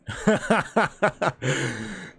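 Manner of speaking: loud, helpless laugh breaking out; real burst of laughter. Real, not acted. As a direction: laugh out loud, caught off guard. Streamer cracks up reading chat.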